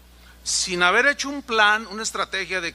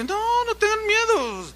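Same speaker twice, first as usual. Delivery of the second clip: reassuring pastor; 13 semitones higher than usual. Retold or imitated. Pastor calms fearful pastors.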